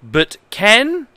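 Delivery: reads broken manual text in a lightly formal voice; straight-faced reading of nonsense instructions; mock-earnest manual reading. Reads bad manual translation aloud.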